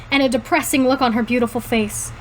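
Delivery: ordinary delivery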